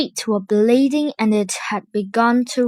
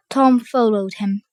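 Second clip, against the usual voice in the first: ordinary delivery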